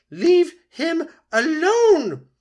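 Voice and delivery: very high, very loud, slow battle cry; the goose driving off the pike; furious shriek. Acted. Loudwing attacking the pike.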